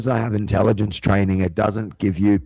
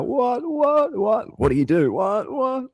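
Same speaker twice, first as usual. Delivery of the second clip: squeaky, much higher repeated whats; panicked niece; slower than usual. Retold or imitated. Niece flustered by the fire drill, squeaky high panic. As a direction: babble like a flustered kid in alarm.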